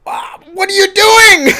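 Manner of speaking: high, loud shriek; shrieking disbelief. Retold or imitated. Reviewer shrieking at the character on screen, high and loud. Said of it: "I go".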